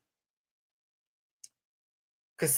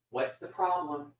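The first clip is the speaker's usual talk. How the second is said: talking to the cat; 4 semitones higher than usual. Real, not acted. Talking to the attention-seeking cat.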